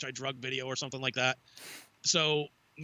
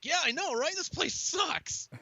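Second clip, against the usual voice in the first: high, slow, sing-song agreement; mock cheerful agreement; 13 semitones higher, slower than usual. Agreeing with his friends that the job sucked, mock chipper. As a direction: sell it like happy news.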